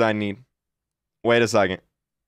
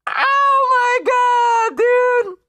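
high shrieking laugh into slow, shouted words; much slower than usual. Real, not acted. Watching the clip's gift of merch, shrieking with laughter. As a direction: crack up and yell it out. Reacting to a clip gift scene.

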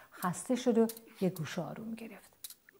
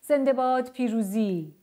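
loud, high, drawn-out victory cheer; triumphant cry; 5 semitones higher, 6 dB louder than usual. A narrator's reading. Dad has won the chase, triumphant shout. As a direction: cheer it out like a champion. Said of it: "بابا فریاد کشید".

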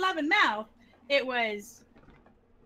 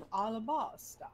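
lightly imitated way of saying it, lower and softer; cute imitation; 4 semitones lower, 9 dB quieter than usual. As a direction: softly mimic his cute pronunciation. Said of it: "when he said Alabaster, it was like".

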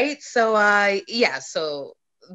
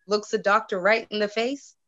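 ordinary talk, nothing marked

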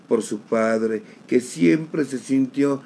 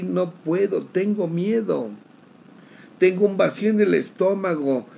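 higher, rising panicked pleading; reliving panic; 6 semitones higher than usual. Retold or imitated. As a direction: climb in fear toward tears. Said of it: "y me solté a llorar".

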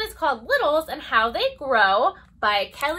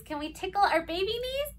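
ordinary delivery

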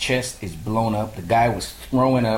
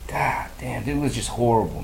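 ordinary talk

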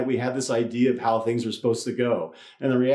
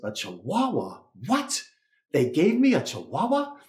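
hammed-up disbelief at the punchline; mock shock; 4 semitones higher than usual. Punchline, man shocked at his dog.

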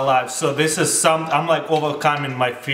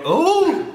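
sour squeal; 12 semitones higher than usual. Real, not acted. Sour lemon bite reaction.

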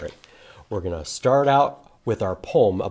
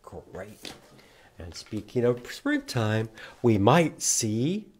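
ordinary talk

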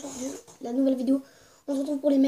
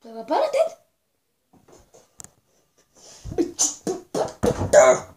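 angry; 3 semitones higher, 5 dB louder than usual. Acted. Élève frappé à la tête.

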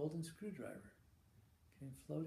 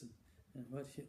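ordinary talk, nothing marked

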